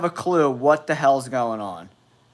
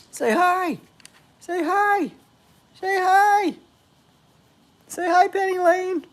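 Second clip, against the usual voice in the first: put-on very high falsetto baby talk to a pet; tiny high pet voice; squeaky dog talk. Talking to his dog.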